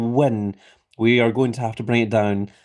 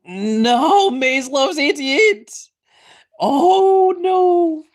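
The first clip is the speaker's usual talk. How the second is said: shocked dismay; 12 semitones higher, 3 dB louder, much slower than usual. Real, not acted. Player eliminated as they spoke.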